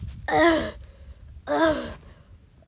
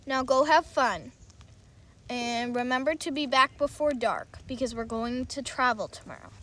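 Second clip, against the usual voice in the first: a hint of a lower, fatherly voice; a father giving kind orders, voiced a bit lower; lightly voiced dad; 8 semitones lower than usual. Dad reminding his son to be home before dark, lightly lowered.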